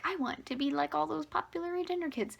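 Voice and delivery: high-pitched mocking quote; lightly hammed-up wannabe.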